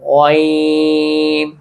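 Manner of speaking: गॉइन stretched very slow and gentle; polite ghain. Shown as a demonstration. Teacher modelling a polite गॉइन for children, slow. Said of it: "थोड़ा सा polite way में लेके आना है".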